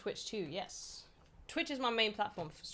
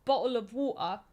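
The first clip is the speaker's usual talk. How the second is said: bottle of water in a clipped British accent; first British take of bottle of water; British accent, one way; 3 semitones higher, 5 dB louder than usual. Shown as a demonstration. Viewer asks for it, says it in British accent. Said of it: "there's different ways".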